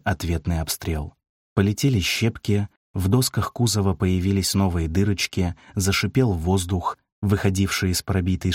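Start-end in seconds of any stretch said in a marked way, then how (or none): none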